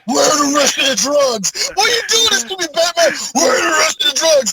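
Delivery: high, whiny pleading thug; hammed-up crying plea; overacted anime dub. Acting beaten thug pleading.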